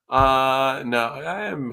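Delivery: ordinary talk, nothing marked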